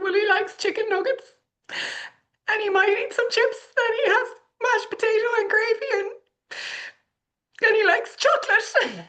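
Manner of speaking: very high, squeaky hammed-up wail; mock crying. Mom confessing junk food to the doctor, mock sobbing. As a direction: confess it in a comic tearful squeal. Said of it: "I'm crying at this stage".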